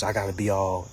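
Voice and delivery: usual speaking voice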